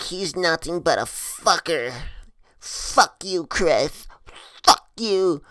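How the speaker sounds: imitating a drunk woman cursing someone out; drunk girl. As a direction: spit out insults in a tipsy put-on voice. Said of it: "her and I already fucked up".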